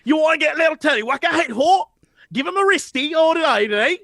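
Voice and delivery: put-on Cockney-Aussie accent. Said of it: "weird mix of Cockney".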